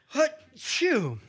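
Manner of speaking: a big put-on sneeze; fake sneeze. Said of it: "I did that sneeze on purpose".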